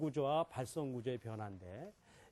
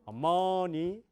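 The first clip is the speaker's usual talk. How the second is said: 어머니 with the middle syllable raised and punched; middle stress; 3 semitones higher, 6 dB louder, much slower than usual. Shown as a demonstration. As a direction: hit the 머 hard. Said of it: "세 가지 말밖에 없어요". (가운데 음절 강세)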